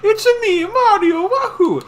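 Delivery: high, loud squeaky Mario voice; Mario impression. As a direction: belt it out like cartoon Mario. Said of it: "that fucking video where it's like".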